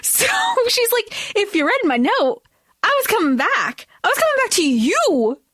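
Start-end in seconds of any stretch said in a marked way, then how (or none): 1.4-5.4 higher-pitched pleading quote; lightly acted heartfelt protest; mock earnest explanation. Elena answering Clay about her note, mock earnest.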